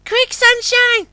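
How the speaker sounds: very high, slow barge voice; calling out. A narrator's reading. The oyster barge calls to Sunshine for help, very high. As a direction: squeak it out in a panic. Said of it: "called Pearl".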